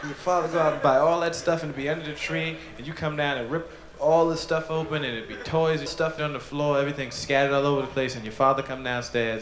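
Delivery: ordinary delivery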